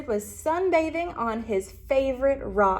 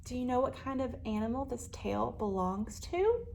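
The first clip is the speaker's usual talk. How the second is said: ordinary delivery, nothing marked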